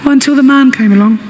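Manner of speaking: put-on a little girl telling her dad about the park; six-year-old daughter answers her father. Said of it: "She's only six".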